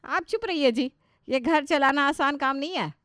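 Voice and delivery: annoyed rebuke. Acted. Wife snaps at husband.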